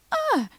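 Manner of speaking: very high mock shriek of fright; startled squeak. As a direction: imitate being caught off guard. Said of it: "and she's like".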